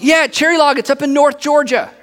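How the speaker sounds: enthusiastic insistence. Acted. Bearer of news explains Cherry Log, enthusiastic. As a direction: insist as if everyone knows it. Acted eager answer about tiny town.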